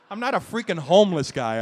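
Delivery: normal talking voice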